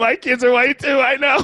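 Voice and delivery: real high-pitched, giddy agreement; amused agreement. Real, not acted. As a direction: agree excitedly, voice jumping way up before laughing. Joking agreement, bursts into laughter.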